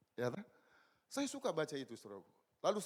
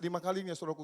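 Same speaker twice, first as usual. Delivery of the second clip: usual speaking voice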